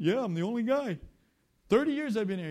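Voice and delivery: loud, high, eager answer; excited castaway. Retold or imitated. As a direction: answer loudly, thrilled to have a listener.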